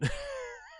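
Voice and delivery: shrill giggle after the bit; high-pitched laugh. Real, not acted. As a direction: burst out in a squeaky laugh. Laugh after the death scene.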